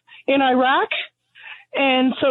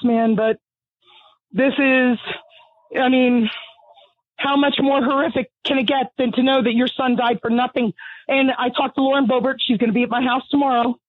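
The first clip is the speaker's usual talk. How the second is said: normal talking voice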